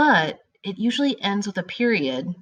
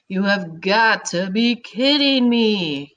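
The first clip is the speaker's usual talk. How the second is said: slow, louder, incredulous exclamation; exclaimed disbelief; 3 semitones higher, 3 dB louder, slower than usual. Shown as a demonstration. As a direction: stretch it out like you can't believe it. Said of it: "You're yelling and you're showing a lot of feeling".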